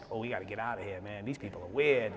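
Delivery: very soft, low, sly tempter voice; devil's whisper-soft tempting. Retold or imitated. The devil on his shoulder urging him to leave, low and soft. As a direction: lure him out in a low murmur. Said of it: "I'm hearing the devil in my own voice".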